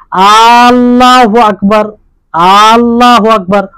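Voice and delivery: আল্লাহু আকবার slowly, with the first আ stretched so it sounds like a question; wrong questioning takbir. Shown as a demonstration. Teacher demonstrating the error that turns the praise into a question, slow and stretched. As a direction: stretch the opening আ of আল্লাহু until it becomes a question. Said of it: "মানে প্রশ্নবাচকে আলো".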